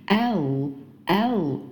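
"L" with tongue tip curled up to the upper gum; drawn-out "L", tongue tip finishing on the ridge; correct dark L. Shown as a demonstration. Teacher demonstrating the tongue position, clear slow dark L. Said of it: "一定要往上卷的，顶到你的上牙龈".

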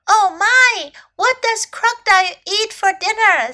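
high, loud astonishment; astonished bird. A narrator's reading. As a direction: echo the question, amazed, high-pitched.

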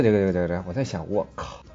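ordinary talk, nothing marked

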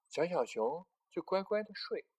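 plain reading voice

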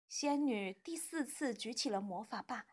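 ordinary delivery, nothing marked

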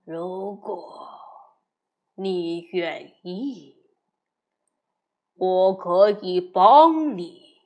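low, slow menacing wolf; slow, deep-voiced stranger offering help. The big red wolf speaks.

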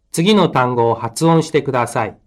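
ordinary talk

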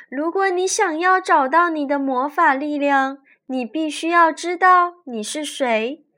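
high, loud sweet lake goddess; sweet, gentle goddess voice. A narrator's reading. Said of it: "女神告诉小仙女".

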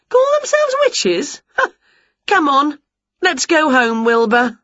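high scornful scoff then cheerful; scoffing witch. A narrator's reading. Winnie scoffing at the fleeing witches, high. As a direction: sniff at the rivals, then breeze off.

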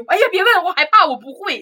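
high, loud panicky teacher voice; mimicking the scared teacher. Mocking the English teacher dodging students' questions, panicky and high. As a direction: squeal it like a teacher terrified of questions. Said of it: "就这种".

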